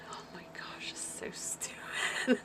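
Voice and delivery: whispered eye-roll at spam. Real, not acted. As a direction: hiss it under your breath in disbelief. Mocking the warranty spam.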